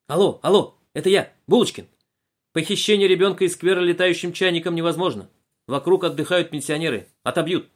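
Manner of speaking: громко, выше, по рации; рапортует в рацию. A narrator's reading. Доклад по рации. (Булочкин докладывает Колобку, громко по рации)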